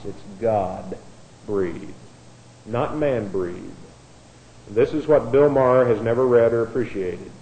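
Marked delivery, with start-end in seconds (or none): 0.1-3.8 much softer and lower, slow and weighty; hushed emphasis. Acted. Preacher to class on inspiration, hushed emphasis. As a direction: drop to a hush to drive it home. Preacher stressing key point.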